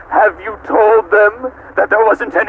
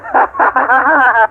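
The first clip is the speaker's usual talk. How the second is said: evil laugh; 5 semitones higher than usual. Acted. AI gloating over captives.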